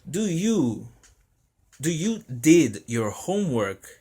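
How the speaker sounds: teacher asking. Acted. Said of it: "o professor pergunta".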